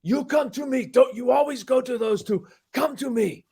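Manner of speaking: high-pitched, riled-up locker-room demand; fired-up teammate. Retold or imitated. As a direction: imitate a fiery teammate demanding attention. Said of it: "He's all mad and jacked up".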